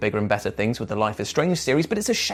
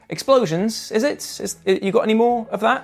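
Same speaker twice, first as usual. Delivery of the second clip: higher-pitched, mock-polite request for more; lightly acted disappointed customer; 6 semitones higher than usual. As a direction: plead a little, then give up politely. Acting out disappointed players asking for more.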